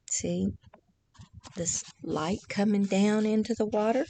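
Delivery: usual speaking voice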